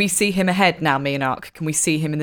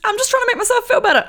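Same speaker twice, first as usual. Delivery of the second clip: defensive high; faster than usual. Real, not acted. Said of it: "I'm sure, but just".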